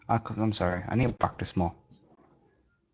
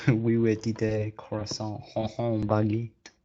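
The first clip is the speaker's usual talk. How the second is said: mock French; slower than usual. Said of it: "Or as the French would say".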